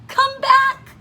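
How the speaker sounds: high, loud call into the distance; high-pitched storybook holler; desperate shout. A narrator's reading. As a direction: shout after something flying away. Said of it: "shouted Cyril".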